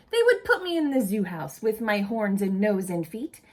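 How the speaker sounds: lively storybook read-aloud voice; animated picture-book reading; reading to children. Acted. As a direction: read it to a group of kids with expression.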